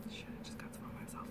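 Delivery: hushed muttered curse under breath; whispered aside. Real, not acted. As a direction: whisper it to yourself, annoyed. Muttered aside while doing makeup.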